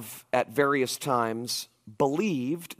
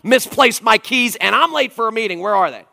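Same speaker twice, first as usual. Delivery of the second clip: higher, louder frantic complaint; barking at everybody; 8 semitones higher, 7 dB louder than usual. Retold or imitated. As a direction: yell at the whole house in a hurry. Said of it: "I bark at everybody".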